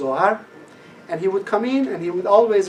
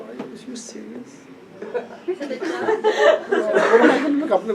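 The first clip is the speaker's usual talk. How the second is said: laughing disbelief; 7 semitones higher than usual. Retold or imitated. His incredulous reply.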